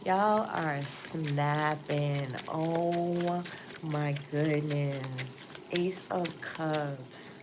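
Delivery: very slow, stretched-out amazement; marveling slowly at the cards; awed. Real, not acted. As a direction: draw each word out in awe.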